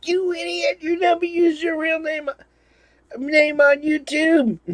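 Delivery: very high squeaky mock rant; mocking Jeff's rant. Friend scolds him for using real name, squeaky mock rant. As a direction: screech it like an exasperated know-it-all. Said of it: "what he was going off about was".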